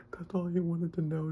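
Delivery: high, loud acted wail; squeaky, overcome mock crying; tearful. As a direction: recreate being moved to tears. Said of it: "I started crying".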